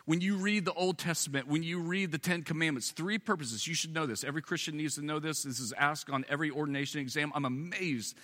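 high, emphatic sermon teaching; insistent preacher laying down doctrine; preaching at full force. Acted. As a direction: drive every point home.

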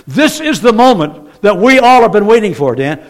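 loud, high, driving sermon climax; full-force preaching. Real, not acted. Preacher announcing the rapture to his congregation, full-force preaching. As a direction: proclaim it with building urgency to the room.

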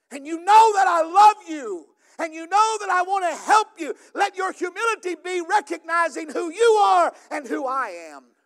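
fervent pastor proclaiming to the church; full-force preaching. Acted. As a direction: drive the scripture home from the pulpit.

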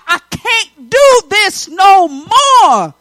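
loud, slow, drawn-out cry of giving up; acted desperation. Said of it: "when you're feeling low, when you're like".

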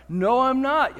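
high, loud protest; heated denial. Acted. Peter contradicting Jesus's prediction, loudly insistent. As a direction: fire back indignantly, voice jumping up. Acted argument, Peter denies it.

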